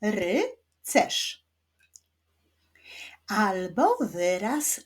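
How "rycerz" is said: "Rycerz" as RY... CERZ, two separate beats; syllable by syllable. Shown as a demonstration. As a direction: clap out "Rycerz" with a pause after ry. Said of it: "wyklaszczmy sylaby w wyrazie rycerz".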